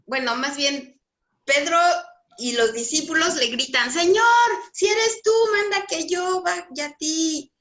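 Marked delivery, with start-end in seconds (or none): 3.9-7.5 calling out. Retold or imitated. Peter calls out to Jesus.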